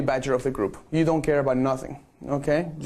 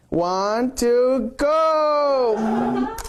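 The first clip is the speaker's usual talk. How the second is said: hyped countdown; 8 semitones higher, 3 dB louder, much slower than usual. Real, not acted. Photographer cueing the group's real laugh, hyped shout. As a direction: count rising higher and louder to a shouted go. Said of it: "let's do it for real this time".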